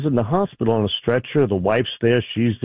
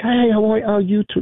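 cheerful greeting; 8 semitones higher than usual. Retold or imitated.

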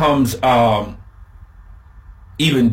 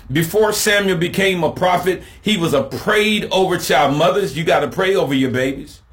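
higher, faster climb into the point; preaching build; 6 semitones higher than usual. Acted. Pastor exhorting mothers to pray over their babies, rising fervor. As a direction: drive it home, rising.

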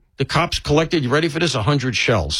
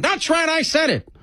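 much higher, punchy radio-host voice doing Levin; Mark Levin impression. Host mocking his own gripe, doing Mark Levin's defiant voice. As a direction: growl it out like a fired-up talk host. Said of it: "That's my Mark Levin".

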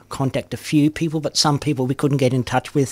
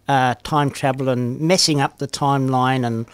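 ordinary talk

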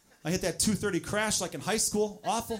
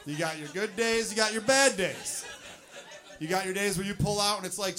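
ordinary talk, nothing marked

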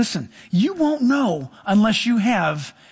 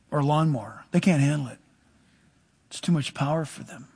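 ordinary talk

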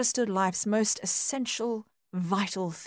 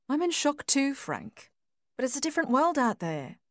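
wife reminding gently; 7 semitones higher than usual. A narrator's reading. Wife pleads with husband.

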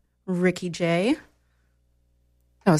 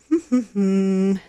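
light laugh. Real, not acted. Laugh after rhetorical question.

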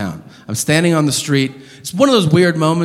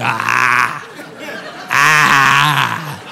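imitated heavy mouth breaths; open-mouth breathing; 2 semitones lower than usual. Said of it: "breathing open mouth style".

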